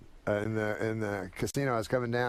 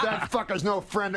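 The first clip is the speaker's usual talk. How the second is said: high-pitched mock outrage; retold indignation; 7 semitones higher than usual. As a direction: imitate an offended comic disowning someone. Acted outburst in a full elevator.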